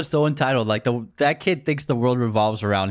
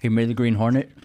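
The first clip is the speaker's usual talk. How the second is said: talking while chewing ice; words around a crunch of ice. Real, not acted. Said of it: "Stop chewing ice on the podcast".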